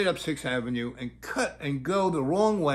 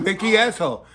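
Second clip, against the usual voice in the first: loud, higher teasing echo; teasing repeat; 4 semitones higher, 6 dB louder, faster than usual. Real, not acted. Comedian teasing the child about her phrase, loud. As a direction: toss the phrase back playfully. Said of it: "Yeah, so".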